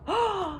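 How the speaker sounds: a sharp high gasp of alarm. A narrator's reading. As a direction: suck in breath, startled. Wumps spot monsters in sky.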